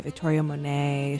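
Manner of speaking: usual speaking voice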